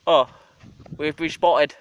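ordinary delivery

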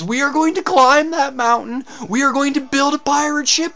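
rally the crew, ending in a pirate yell; rousing declaration. Acted. Leader rallying out-of-work cooks to piracy, rousing shout.

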